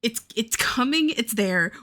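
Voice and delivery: normal talking voice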